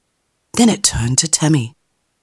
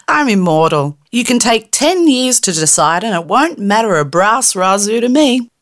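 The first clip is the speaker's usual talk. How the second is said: scoffing fairy; 7 semitones higher than usual. Acted. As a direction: brush off her worry from on high.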